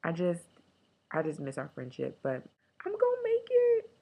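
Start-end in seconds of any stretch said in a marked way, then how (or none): 2.8-3.9 ridiculous high squeaky cheer, loud; high-pitched self pep talk; pep-myself-up voice. Shown as a demonstration. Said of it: "That's my pep myself up voice".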